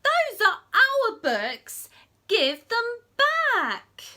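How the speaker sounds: loud, slow, high shout of protest; children shouting to get their books back; demanding yell. Acted. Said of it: "the children yelled".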